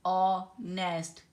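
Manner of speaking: ऑनेस्ट drawn out slowly with a silent h; slow demo of ऑनेस्ट, no h; slow example word. Shown as a demonstration. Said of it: "जैसे ये था".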